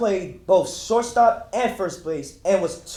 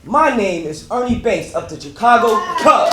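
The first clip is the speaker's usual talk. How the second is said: proud loud introduction; 2 semitones higher, 7 dB louder than usual. Acted. Proud self-introduction as Ernie Banks.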